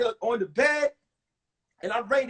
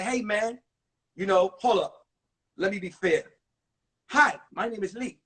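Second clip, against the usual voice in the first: Lee's voice; 5 semitones lower than usual. Retold or imitated. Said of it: "I don't know if Lee spoke like that, but that's just the sound I'm gonna give him".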